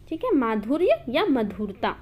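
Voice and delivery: ordinary talk, nothing marked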